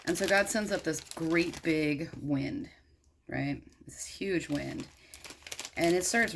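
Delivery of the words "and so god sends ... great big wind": ordinary delivery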